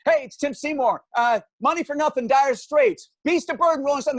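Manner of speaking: over-eager radio contest caller; excited caller. Retold or imitated. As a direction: blurt it all out, heart pounding. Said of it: "And I say".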